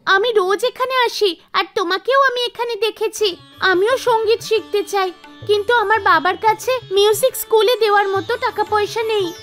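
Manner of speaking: put-on high, earnest child voice; a small child explaining herself; hopeful little pupil, pitched high; little girl. Sharda explains her wish to learn.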